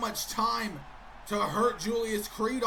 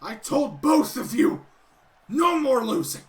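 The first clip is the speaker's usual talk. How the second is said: louder, stern telling-off; scolding; 7 dB louder than usual. Acted. Gable scolding his losing partners, louder and stern. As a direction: lay down the law to them.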